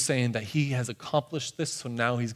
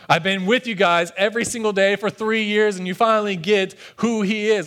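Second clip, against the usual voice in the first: raised, louder exasperation with relief; pitched-up voicing of relieved exasperation; exasperated relief; 6 semitones higher, 5 dB louder than usual. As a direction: vent it, exasperated but relieved. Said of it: "exasperated sigh of relief".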